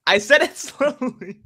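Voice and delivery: high, drawn-out words through a laugh; laughing protest. Real, not acted. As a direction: protest slowly while cracking up. Amused by own slow-name joke.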